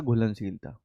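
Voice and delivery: घुलनशीलता said low and very soft, falling; whisper-soft prompt of घुलनशीलता; soft model answer. Shown as a demonstration. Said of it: "क्या होता है?".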